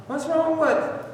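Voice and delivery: mock hurt plea on the phone; pleading caller. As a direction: act out a hurt friend asking why. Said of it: "I hear".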